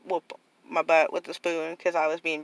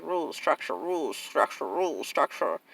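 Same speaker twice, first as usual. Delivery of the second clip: mock rule drilling; 2 semitones lower, 4 dB quieter than usual. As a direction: drone the rules like a mock taskmaster. Mimics relentless strict rule-giving.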